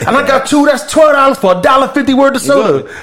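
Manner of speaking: high-pitched, heated rant about the price; outraged rant. Retold or imitated.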